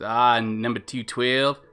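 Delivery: casual Kiwi accent sample; New Zealand accent. Shown as a demonstration. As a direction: try out New Zealand vowels. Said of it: "New Zealand".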